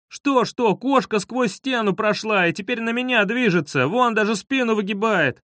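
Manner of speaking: high-pitched frantic explanation; high, urgent panic; panicked report. A narrator's reading. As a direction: blurt it out in alarm, pitch up throughout.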